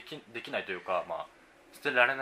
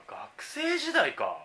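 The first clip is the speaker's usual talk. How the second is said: "学生時代か" said much higher and very slowly; an exaggerated, high, drawn-out musing; 12 semitones higher, 3 dB louder, much slower than usual. Shown as a demonstration. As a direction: float it up high and stretch it out. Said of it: "学生時代に買ったもの".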